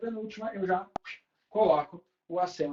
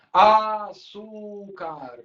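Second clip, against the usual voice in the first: AÇÚCAR very slow, every syllable stretched alike; drawn out evenly; 5 dB louder, much slower than usual. Shown as a demonstration. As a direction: say "açúcar" syllable by syllable, loud and dragging. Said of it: "se tu fizer assim".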